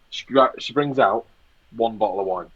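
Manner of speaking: ordinary talk, nothing marked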